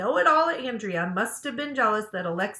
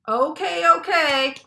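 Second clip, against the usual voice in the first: loud, higher call for attention; calling the class to order; 7 semitones higher, 9 dB louder, slower than usual. Acted. Teacher calling the chattering class to order, loud and high. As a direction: clap and call them back to work.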